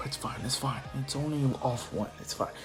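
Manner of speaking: soft, quick nervous reassurance to himself; calm yourself down under your breath; muttered self-reassurance. Acted. Izuku panicking, telling himself it's fine, quietly.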